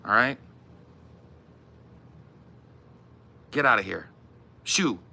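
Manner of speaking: mock grumpy veteran telling off a fan; gruff old-timer shooing a kid. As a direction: shoo the kid off with swagger. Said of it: "I'm like".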